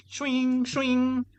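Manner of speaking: high, ringing "shwing" blade sound; mimicked swish of a swinging scythe; scythe swing noise. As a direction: imitate a blade slicing through the air, twice. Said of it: "like, to chop your head off".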